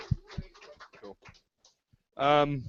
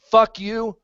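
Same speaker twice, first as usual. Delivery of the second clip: loud, higher defiant curse flung at the judge; defiant shout; 6 semitones higher, 12 dB louder than usual. Retold or imitated. Speaker retelling his answer to the judge, shouted defiance. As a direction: spit it back at him, loud.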